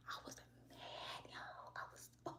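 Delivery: hushed, breathy aside to the viewers; whispered confession. Real, not acted. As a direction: drop to a secret whisper.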